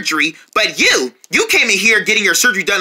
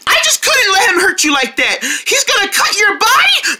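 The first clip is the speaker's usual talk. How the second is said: panicked shouting; 10 semitones higher than usual. Acted. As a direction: yell to stop the doctor. Panicked protest.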